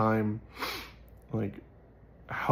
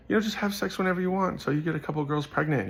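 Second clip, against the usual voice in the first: lightly imitated friend, higher pitched; glib friend; 7 semitones higher than usual. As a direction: mimic a friend's casual advice up high. Retells friend's glib advice.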